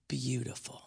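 breathy hush on the word beautiful; whispered. Real, not acted. Preacher to congregation, soft whisper. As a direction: drop to a whisper to make it sink in.

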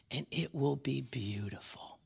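sinks into a whisper. Real, not acted. Preacher to congregation, whispered. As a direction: let it fall to a whisper. Preacher hushes on key word.

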